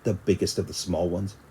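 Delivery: usual speaking voice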